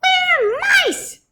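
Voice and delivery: very high squeaky chorus; tiny mice squeaking in unison. Acted. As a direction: squeak it back proudly. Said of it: "they squeaked".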